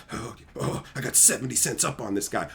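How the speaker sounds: soft, low, moaning imitation of petty gloating; mocked gloating trader. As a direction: moan with mock pleasure over a tiny win. Mocking a trader gloating at home.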